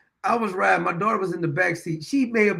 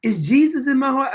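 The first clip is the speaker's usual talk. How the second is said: little daughter asking; 5 semitones higher, 4 dB louder than usual. Retold or imitated. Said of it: "She said".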